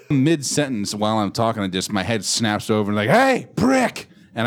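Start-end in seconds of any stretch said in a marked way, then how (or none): 3.1-4.1 sudden much higher yell; retold yell at the bully, much higher and louder; shout. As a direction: snap and yell across the room. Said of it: "I just start yelling".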